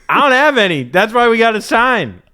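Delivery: high-pitched, worked-up urging; exasperated pleading. Pretend. Co-host urging partner to sign the deal, exasperated high plea. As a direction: beg your partner loudly, half laughing.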